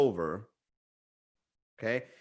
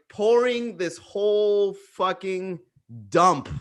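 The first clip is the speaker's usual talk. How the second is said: normal talking voice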